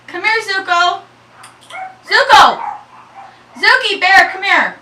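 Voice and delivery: very loud, high dog call; yelling. Real, not acted. Frazzled owner calls dogs over, yelling. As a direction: yell the pet's name and order it over. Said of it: "I'm so sorry for yelling".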